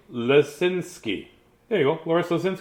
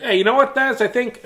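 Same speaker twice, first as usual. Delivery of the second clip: a light higher put-on voice; wrong accent for dentist; 9 semitones higher than usual. Dentist quoted in the wrong accent, lightly put on. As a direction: try a voice that doesn't fit. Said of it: "'cause that's not his accent".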